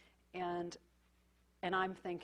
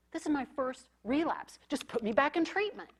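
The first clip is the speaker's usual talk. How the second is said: imitating an upset teen; 8 semitones higher, 8 dB louder than usual. Quoting an agitated teen patient.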